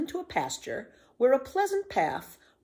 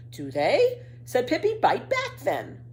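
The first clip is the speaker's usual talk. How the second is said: cheeky retort; 11 semitones higher, 5 dB louder, slower than usual. A narrator's reading.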